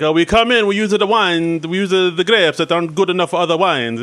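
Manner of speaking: hammed-up fake Italian accent; over-the-top Italian accent. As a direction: put on a cartoonish Italian accent. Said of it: "just over-the-top Italian accents".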